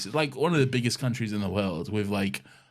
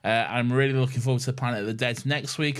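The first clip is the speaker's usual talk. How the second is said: ordinary talk, nothing marked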